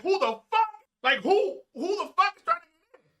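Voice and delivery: high, loud yell at an intruder; re-enacted yell. Man reenacting yelling at whoever opens the door, loud yell. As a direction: yell it high with laughter breaking in. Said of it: "So I yell".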